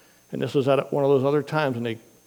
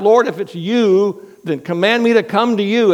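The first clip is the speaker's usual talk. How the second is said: crying out; 7 semitones higher, 5 dB louder than usual. Retold or imitated. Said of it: "Peter cries out".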